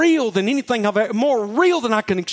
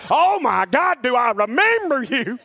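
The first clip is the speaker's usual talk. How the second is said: higher, imitated old lady's exclamation; elderly woman exclaiming; 5 semitones higher, slower than usual. The old teacher recalling her unruly pupil, in an imitated high exclamation. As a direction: do the old teacher, amused and exasperated. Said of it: "That's exactly how she said it".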